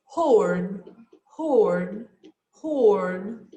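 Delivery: teacher dictating a listening item; dictation word. Shown as a demonstration. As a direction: read the test word slowly. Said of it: "Number four".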